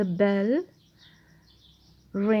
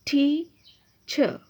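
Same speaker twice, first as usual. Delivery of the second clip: "Teacher" slow and higher, cut in two; syllable by syllable; 4 semitones higher, much slower than usual. Shown as a demonstration. Teacher presenting a new word to pupils, "teacher" split slowly in two. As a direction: break "teacher" into tea, cher with a pause between. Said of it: "teacher की syllable division देखें".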